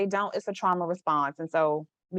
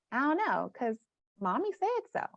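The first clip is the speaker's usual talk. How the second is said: small, high, soft reply; softer, higher, acted-out little voice; childlike client; 5 semitones higher, 6 dB quieter than usual. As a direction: imitate a sheepish child's answer.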